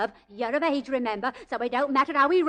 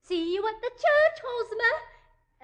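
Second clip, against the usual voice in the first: much higher call after someone; mother calling from the cab to the groom; 11 semitones higher, slower than usual. Acted. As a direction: sing it out to the groom as the cab pulls away.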